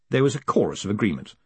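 plain reading voice

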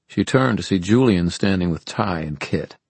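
ordinary delivery, nothing marked

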